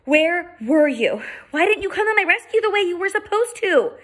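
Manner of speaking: put-on girl scolds Charlie, high and accusing; hurt reproach. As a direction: demand answers, hurt and upset.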